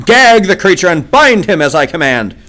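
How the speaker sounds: loud, high angry order; furious command. A narrator's reading. The jedak angrily orders the prisoner gagged. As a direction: bark the order in fury. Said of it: "roared Tal Hages".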